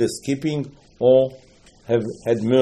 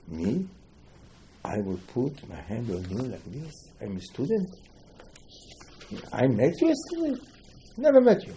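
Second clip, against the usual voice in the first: incredulous denial; 7 dB quieter than usual. Retold or imitated. Rabbi Chia denies meeting him.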